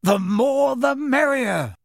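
high, slow, hearty welcome; sincere, hearty. Acted. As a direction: call it out with real warmth. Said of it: "with such obvious sincerity".

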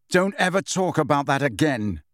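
ordinary delivery, nothing marked

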